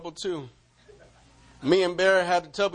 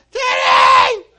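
high, loud call; crying out; 12 dB louder, slower than usual. Retold or imitated. Owner crying out for the lost dog, loud high call. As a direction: shout the name down the block. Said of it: "I was crying out for Teddy".

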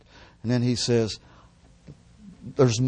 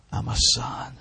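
whispered declaration. Real, not acted. Repeated declaration fading to whisper.

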